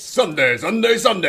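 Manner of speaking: booming monster truck announcer. Shown as a demonstration. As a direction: hype it like a rally ad. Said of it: "Give me the Monster Truck Rally announcer voice".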